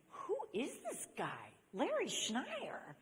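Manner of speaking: slow, swooping, stretched-out wonder; amazed disbelief. Retold or imitated. Woman suddenly sees old friend differently, drawn-out amazement. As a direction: draw out the name in mock astonishment.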